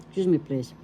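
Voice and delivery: ordinary talk, nothing marked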